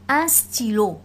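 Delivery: « stylo » avec y prononcé i, sti-lo; un stylo, y comme i. Shown as a demonstration. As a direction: dis « stylo » en faisant sonner ti. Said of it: "je prononce ti". (« stylo » : le y se dit i)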